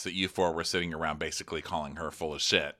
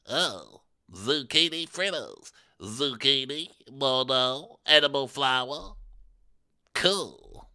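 higher, slow drawling menu reading; mock unimpressed diner; 4 semitones higher, slower than usual. Imitating Erika reading the dinner menu. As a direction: read the menu with faint disdain. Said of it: "Eric is like".

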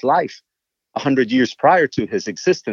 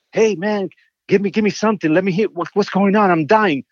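higher, imitating an eager pleading singer; 8 semitones higher than usual. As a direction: retell his impatient begging in a lifted voice. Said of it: "[name] will call me".

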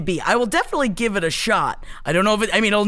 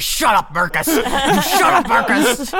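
mock angry wife; 4 semitones higher, slower than usual. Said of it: "And she's like".

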